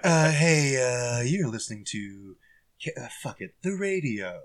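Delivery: low, halting, soft DJ voice; unenthused DJ losing the thread; inept radio DJ. Acted. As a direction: fumble the station intro half-heartedly. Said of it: "What did the D J come in and say?".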